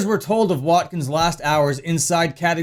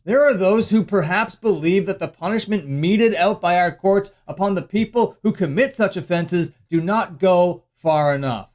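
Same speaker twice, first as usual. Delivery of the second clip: normal talking voice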